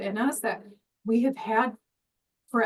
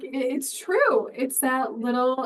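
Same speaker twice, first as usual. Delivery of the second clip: normal talking voice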